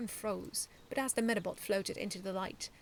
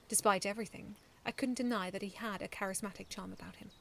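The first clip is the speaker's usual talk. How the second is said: plain reading voice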